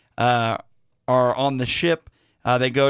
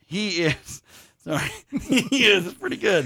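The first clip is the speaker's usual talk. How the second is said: laughing through the words; trying not to laugh; 4 semitones higher than usual. Real, not acted. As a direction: fight back giggles as you speak. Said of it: "I'm losing it over here".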